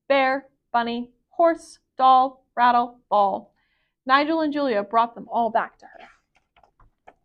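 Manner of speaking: plain reading voice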